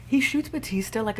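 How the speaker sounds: ordinary delivery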